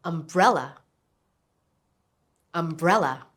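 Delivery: "Umbrella" stressed on the middle part, BREL; stress on second syllable. Shown as a demonstration. Teacher letting learners hear the stress, "Umbrella" stressed on the second syllable. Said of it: "It's on the second syllable".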